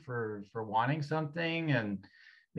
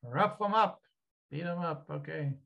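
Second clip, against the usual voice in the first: tough Irish energy; 4 semitones higher than usual. Acted. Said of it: "back to more of the Irish".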